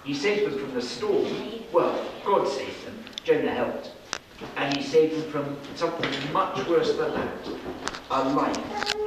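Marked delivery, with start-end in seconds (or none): none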